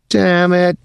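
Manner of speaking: high, sudden curse; startled curse. Acted. Dwyer seeing the log in the wake, startled curse. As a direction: blurt it out in shock.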